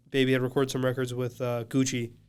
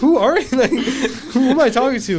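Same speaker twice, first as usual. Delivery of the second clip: high, loud mock bewilderment; baffled outburst; 11 semitones higher, 8 dB louder than usual. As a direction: laugh through a retold disbelieving question. Retold baffled reply to unknown caller.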